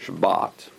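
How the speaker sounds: "Shabbat" slowly, with an open "ah"; proper Shabbat. Shown as a demonstration. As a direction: lengthen the last vowel of "Shabbat" as "baaht". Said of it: "that's the proper way".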